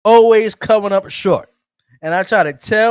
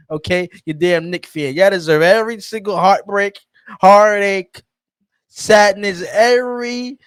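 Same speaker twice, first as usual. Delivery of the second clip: ordinary delivery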